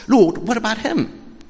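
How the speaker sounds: high, loud, slow plea from a worried Peter; anxious Peter. Acted.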